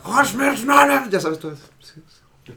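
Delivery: mock German shouting. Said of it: "hablan muy fuerte".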